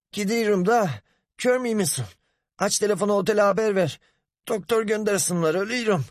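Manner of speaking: high, strained dying plea; clutching his chest, gasping out words; dying man. A narrator's reading. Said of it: "Hasan göğsünü tutarak". (göğsünü tutarak can çekişen ses)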